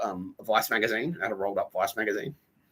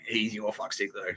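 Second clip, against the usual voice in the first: whisper; 6 dB quieter, slower than usual. Retold or imitated. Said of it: "And he's like".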